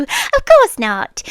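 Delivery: usual speaking voice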